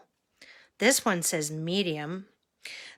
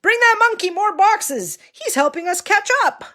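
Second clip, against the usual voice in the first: high excited shout; yelling; 12 semitones higher, 8 dB louder than usual. Acted. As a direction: call out gleefully over the noise. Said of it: "Somebody noticed and yelled".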